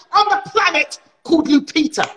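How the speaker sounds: very high exasperated climax; indignant rant. Acted.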